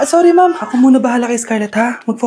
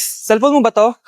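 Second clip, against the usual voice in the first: normal talking voice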